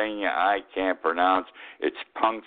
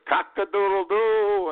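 high, drawn-out rooster call; rooster crow; 13 semitones higher, slower than usual. Retold or imitated. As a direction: crow like a rooster waking the farm. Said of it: "they wake the farmer up with".

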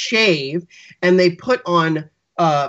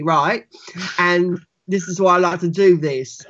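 menacing gutter-born British character; perverted British accent. Shown as a demonstration. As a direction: do a skewed, un-posh British accent as an intruder. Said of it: "something a little bit like this".

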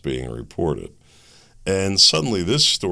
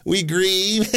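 higher, slow, sing-song mock of the vice president; mocking a politician; 12 semitones higher, 3 dB louder than usual. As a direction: parody her grave speech, pitched up and drawn out. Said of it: "I wonder if she giggled when she said that".

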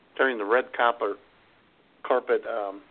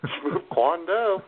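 drawn-out mock title; 7 semitones higher, 3 dB louder, much slower than usual. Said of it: "Schmoop did an awesome job".

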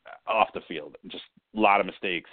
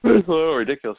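yawning; 8 semitones higher, 8 dB louder, faster than usual. Real, not acted. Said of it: "Excuse my yawn here".

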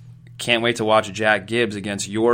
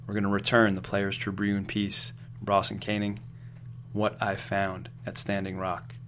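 normal talking voice

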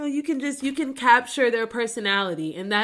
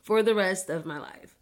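normal talking voice

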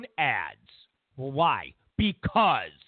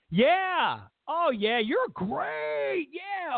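high, gushing yeah; butter the caller up, high and eager; mock Trump flattery; 8 semitones higher, much slower than usual. Trump flattering the caller on the phone, high gushing voice. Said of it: "Trump was like".

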